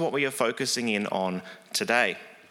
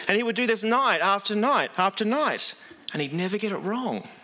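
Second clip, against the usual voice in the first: usual speaking voice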